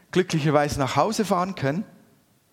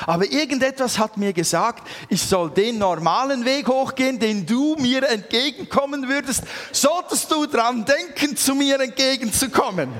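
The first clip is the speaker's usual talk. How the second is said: high and louder, more and more amazed; a wife telling the wonder, rising higher; excited wife; 8 semitones higher, 3 dB louder than usual. Retold or imitated. Wife's amazed report. (begeisterte Ehefrau, immer höher und lauter)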